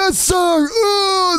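mock crying. Forced eating.

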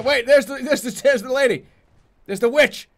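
loud, high, urgent callout; excited gamer yelling; excited spotting. Real, not acted. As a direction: call out the sighting in a rush. Spotting the witch mid-game.

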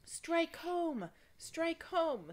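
high, loud screaming encouragement; high shouted chant from the crowd. Acted. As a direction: scream it up at the hero on the ladder. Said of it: "screamed the crowd outside".

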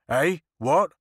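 put-on sharp loud outcry from the old mayor; startled loud cry of the blustering mayor; cried out. A narrator's reading. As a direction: bark the question out loud. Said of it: "cried the mayor".

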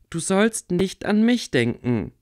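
desperate demand. A narrator's reading. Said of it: "forderte sie verzweifelt".

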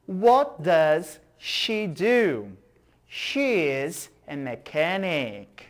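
each English line read slowly and clearly; slow model dialogue. Shown as a demonstration. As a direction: read it slowly so students copy. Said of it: "slow model dialogue". (diálogo modelo lento e claro)

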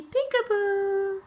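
slow, high singsong peekaboo; playful peekaboo. Real, not acted. Mother playing peekaboo with baby, slow singsong. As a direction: draw it out playfully.

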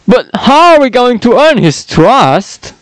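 high, goofy cartoon dog voice; accidental Goofy voice. Henchman asks how to earn trust, sounding like Goofy. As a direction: slip into a Goofy impression. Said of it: "not meant to sound like Goofy there".

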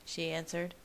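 usual speaking voice

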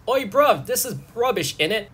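high, punchy British slang voice; British accent. Acted. Porcupine moaning about the water, in a British accent. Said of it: "a porcupine is more British".

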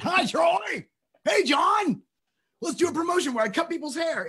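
high, loud mock-cheery holler; mock calling out. Hollering to Troy and John about a promotion, mock calling out. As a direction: shout it up high, putting on an eager pitch. Calls out to station staff.

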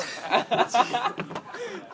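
giggling fit; can't stop laughing; high breathy laughter. Real, not acted. Cracking up at the texts.

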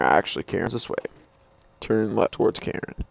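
usual speaking voice

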